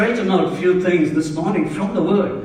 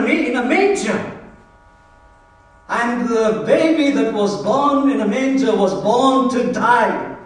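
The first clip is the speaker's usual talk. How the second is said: high-pitched, insistent declarations; forceful preaching; 6 semitones higher, 3 dB louder than usual. Real, not acted. Preacher telling church why He came, forceful preaching. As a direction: drive each phrase home in a raised voice.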